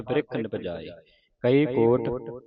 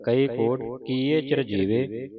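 ordinary delivery

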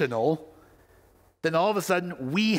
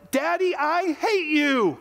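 put-on high-pitched, drawn-out angry child's scream; erratic yell; 5 dB louder, slower than usual. Enraged son screaming at his dad, high frantic yell. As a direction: yell it like a furious kid losing it. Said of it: "he erratically yelled".

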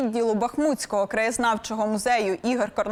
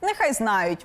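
ordinary talk

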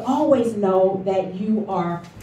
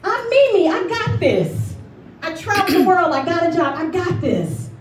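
mock bravado; 6 semitones higher than usual. Mocking own stubborn confidence.

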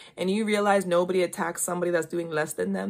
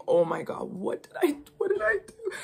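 rising, much higher panicked question; 8 semitones higher than usual. Retold or imitated. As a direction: let the panic climb higher. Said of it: "he's now like".

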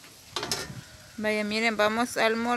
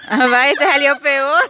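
laughing; 3 semitones higher, 6 dB louder, slower than usual. Real, not acted. Laughing at failed word.

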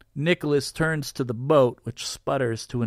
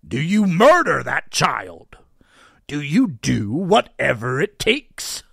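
pressing; 7 dB louder than usual. A narrator's reading. Nicholas presses hard.

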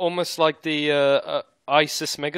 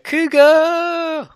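high shouted "Cougar!" like a hero transforming; henshin call; 14 semitones higher, 6 dB louder than usual. Retold or imitated. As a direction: belt out "Cougar!" high and loud like a transformation cry. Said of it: "don't they go".

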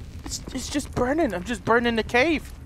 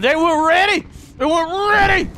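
hyped; 7 semitones higher, 5 dB louder than usual. Real, not acted. Hyped rat fight.

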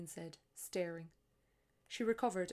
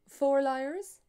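puzzled echo; 7 semitones higher, 14 dB louder than usual. Acted. Tourist puzzled.

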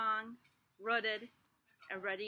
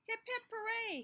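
higher excited hooray; cheering; 11 semitones higher than usual. Acted. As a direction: cheer him on. Cheer for the seed.

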